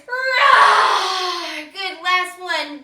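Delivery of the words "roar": louder roaring cry; long playful lion roar. Shown as a demonstration. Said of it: "Two more times".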